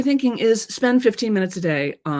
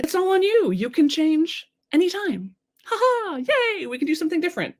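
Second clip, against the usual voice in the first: much higher, playful celebration; good news; 11 semitones higher than usual. Acted. Coach showing the upside of it being on you, playful good news. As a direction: deliver it as happy news, pitched way up. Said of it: "good news, bad news".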